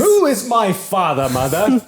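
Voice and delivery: high, loud bratty demand; spoiled boy-king in a shrieky strop; Joffrey. Acted. Boy king questioning his mother, high and loud. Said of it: "that boy does not know who his daddy is".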